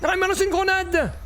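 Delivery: high alarmed shout of warning; grenade warning yell. Real, not acted. Enemy throws a grenade at him.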